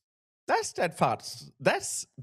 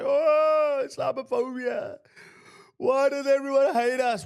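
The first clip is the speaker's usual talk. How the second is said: high, sing-song mock complaint; mocking whiny victim; 10 semitones higher, 3 dB louder than usual. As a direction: whine it like a self-pitying victim, much higher. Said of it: "and then they say".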